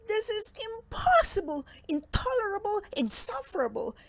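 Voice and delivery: high-pitched exasperated outburst; angry disbelief. Acted. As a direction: rant word by word in outrage. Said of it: "shaking with anger and disbelief".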